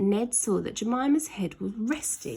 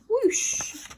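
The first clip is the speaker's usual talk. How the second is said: high airy whoosh; whoosh sound; 14 semitones higher than usual. A narrator's reading. Narrator voicing Ned flying into the sky, a high whoosh. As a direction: fling the sound upward.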